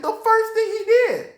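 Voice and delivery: "the first thing he did" cried very high; emphatic outrage. Real, not acted. Preacher insisting it was not the second, outraged emphasis. Repeat tops the previous one.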